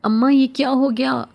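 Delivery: desperate wail to mother; anguished cry. A narrator's reading. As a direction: cry out in shock at a death. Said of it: "میں چیخ اٹھا".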